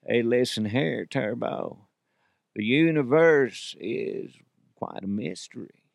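attempted laid-back Southern actor, trailing off softly; slow, drawn-out Southern impression; Matthew McConaughey. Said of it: "I can't do Matthew McConaughey".

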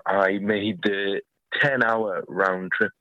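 ordinary talk

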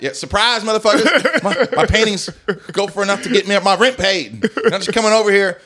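loud, high-pitched comic indignation; mock outraged rant. Painter scolds friends over cheap-painting requests, mock outrage.

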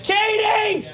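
high-pitched yelled name; demonstration yell. Shown as a demonstration. Co-host showing he can still yell in here, high-pitched yell. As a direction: yell it out to show you can. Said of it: "I can yell".

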